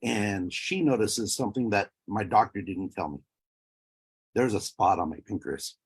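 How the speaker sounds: ordinary delivery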